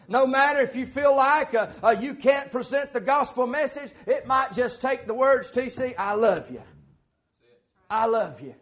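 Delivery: loud, high fervent preaching; impassioned pulpit exhortation; preaching at full force. Real, not acted. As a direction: drive the point home to the congregation.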